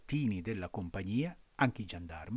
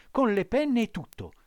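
ordinary delivery, nothing marked

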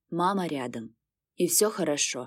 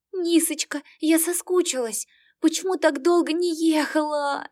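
high, tearful cry of joy; bursting into happy tears; 9 semitones higher than usual. Acted. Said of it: "сразу разревелась".

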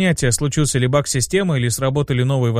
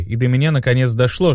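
ordinary delivery, nothing marked

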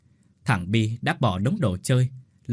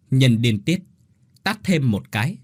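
plain reading voice

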